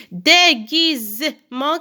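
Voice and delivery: "Déguisement" slow, in separate beats; syllable by syllable. Shown as a demonstration. Teacher counting syllables for pupils, slow and segmented. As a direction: draw out each syllable of "Déguisement". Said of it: "quatre syllabes".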